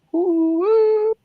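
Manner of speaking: high, drawn-out "whoo"; imitated whoop back. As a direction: hoot a long, high echoing call. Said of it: "like, loud whoop".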